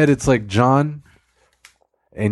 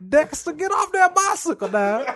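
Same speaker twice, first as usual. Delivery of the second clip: high scolding voice. Pretend. Character scolding Dexter.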